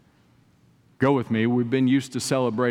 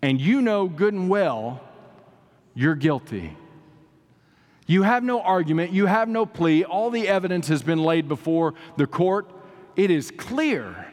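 high-pitched, emphatic sermon delivery; fervent preacher with raised pitch and deliberate pauses; impassioned preaching; 6 semitones higher than usual. Real, not acted. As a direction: proclaim it phrase by weighty phrase to a congregation. Preaches scripture and courtroom parable.

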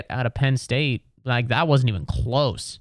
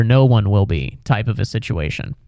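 ordinary talk, nothing marked

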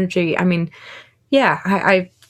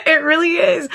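laughing; 10 semitones higher than usual. Real, not acted. Laughing at tough question.

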